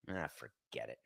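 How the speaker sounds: low, barely audible mutter; muttered under breath. Real, not acted. Giving up on recalling a word, a low mutter. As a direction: drop it to a defeated mutter.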